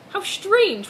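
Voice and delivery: usual speaking voice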